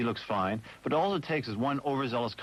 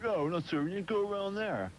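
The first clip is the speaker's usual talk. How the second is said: high-pitched mock snooty refusal; 9 semitones higher than usual. Bar staff turning away a customer, mimicked as snooty. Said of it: "they got really snooty about".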